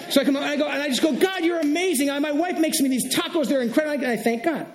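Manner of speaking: high, gushing excitement; excited preacher. Real, not acted. Preacher raving to the church about his wife's tacos, excited. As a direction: let the pitch leap with delight. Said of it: "I'm getting a little excited here".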